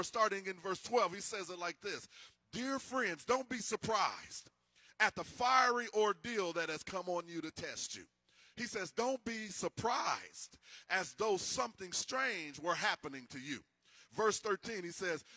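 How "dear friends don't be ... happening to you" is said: scripture reading. Acted. Reads scripture slowly.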